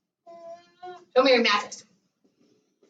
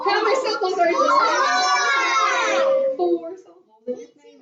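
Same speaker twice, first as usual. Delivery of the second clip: excited amazement; 11 semitones higher, 4 dB louder than usual. Real, not acted. Teacher marvels at the long name.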